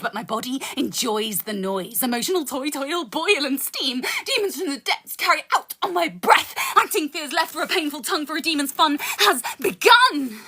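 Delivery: rhythmic poetry recital with dark punchlines; spoken word poem. Acted. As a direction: perform it like a slam poem. Continues the poem.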